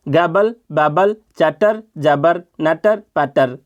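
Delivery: slow, deliberate listing of the words; slow read-aloud. Shown as a demonstration. Teacher reads the word list slowly for students. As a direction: read each word slowly so they hear the rhythm. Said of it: "अब मैं इसको पढ़कर सुनाता हूँ".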